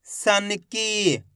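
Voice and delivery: सन की very slow, long ई at the end; joined-up syllables. Shown as a demonstration. Teacher blending the letters for students, slow syllable-by-syllable reading. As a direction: join सन की slowly, closing न and holding की. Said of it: "एक अक्षर का उच्चारण".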